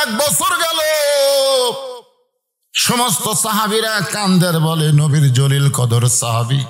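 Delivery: impassioned sing-song preaching; melodic sermon storytelling. A narrator's reading. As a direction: tell it as a chanting preacher, stretching line endings. Preacher tells story in chant.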